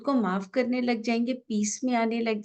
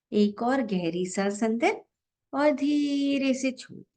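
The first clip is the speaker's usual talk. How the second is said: guided meditation; 3 semitones lower than usual. Real, not acted. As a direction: guide each breath gently, drawing out the exhale cues. Leads a breathing meditation.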